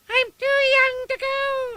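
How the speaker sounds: high, slow wailing scream. Acted. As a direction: howl it like a dragged-off baby. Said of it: "I screamed".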